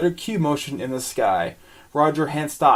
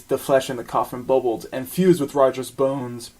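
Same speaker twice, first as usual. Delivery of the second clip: normal talking voice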